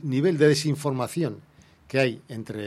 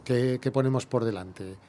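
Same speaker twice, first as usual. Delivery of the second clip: ordinary talk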